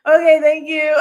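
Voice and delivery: high, loud, choked thank you; teary thanks. Retold or imitated. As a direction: thank her through tears. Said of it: "I was like".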